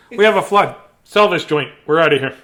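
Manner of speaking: playful hammed-up alarm; mock panic. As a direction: blurt it out like you're fleeing the flood. Voicing duck's panicked exit.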